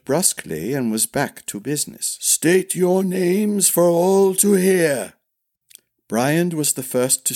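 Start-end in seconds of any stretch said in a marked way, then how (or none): 2.2-5.1 high, slow ceremonial command; formal old master-at-arms. Acted. Master-at-arms calling princes to declare themselves, ceremonial. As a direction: proclaim it to the whole crowd.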